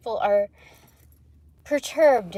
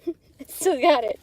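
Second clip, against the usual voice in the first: laughing brag, a bit higher; 5 semitones higher, 3 dB louder than usual. Real, not acted. Bragging to viewers through a laugh. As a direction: gloat with a laugh.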